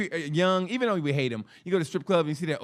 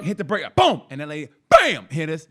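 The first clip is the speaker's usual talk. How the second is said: ordinary talk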